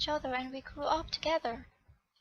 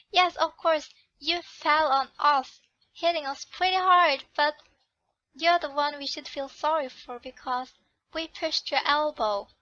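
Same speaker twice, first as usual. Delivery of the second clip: sarcastic retort; 6 dB louder than usual. Acted. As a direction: lay the sarcasm on thick. Girl sarcastic to the noble.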